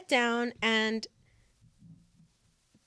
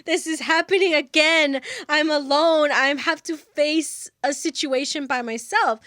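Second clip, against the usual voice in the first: loud, higher, exasperated complaint; furious; 4 semitones higher, 6 dB louder than usual. Retold or imitated. Teller fumes that Joel is not answering, furious. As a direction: relive the fury of being left alone. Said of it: "I'm furious".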